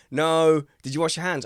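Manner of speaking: playfully stern parent checking up; mock parent.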